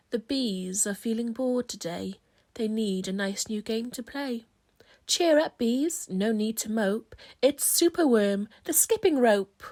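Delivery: usual speaking voice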